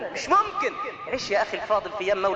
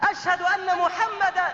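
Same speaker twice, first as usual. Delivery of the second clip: breaking off in tears; 9 semitones higher than usual. Retold or imitated. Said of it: "بكى بلال، توقف الصوت".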